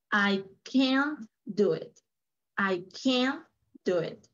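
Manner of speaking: CAN'T stressed and drawn out, slow; emphatic negative. Shown as a demonstration. Teacher modelling the negative sentence for student, stressed CAN'T. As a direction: lean long on the word "can't". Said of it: "en el negativo hay un énfasis".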